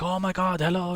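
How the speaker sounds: high, delighted hello; excited greeting. Retold or imitated. Shop guys meeting his cousin, excited and high. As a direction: gush over the new guest.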